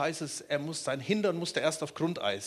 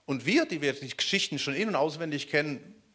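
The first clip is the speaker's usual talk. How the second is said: normal talking voice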